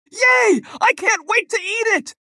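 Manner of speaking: put-on high squealing child cheer; excited shout. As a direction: burst out with delight. Boy thrilled with gingerbread.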